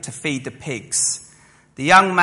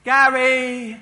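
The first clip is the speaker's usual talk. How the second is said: higher, louder call up the stairs; mum calling up; 9 semitones higher, 5 dB louder than usual. Retold or imitated. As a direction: call your son's name from downstairs. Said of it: "she'd be like".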